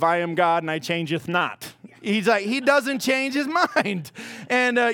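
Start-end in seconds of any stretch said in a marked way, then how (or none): 0.0-1.7 lower, lofty King James God voice; solemn voice of God. Retold or imitated. Preacher voicing God's unbending word, solemn and lofty. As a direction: intone it like an old Bible verse. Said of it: "That's the other ugly side of".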